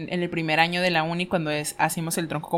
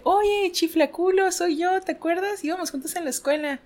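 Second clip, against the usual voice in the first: cheerful greeting; 9 semitones higher than usual. Acted. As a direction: greet an old pal. Joke character catching up.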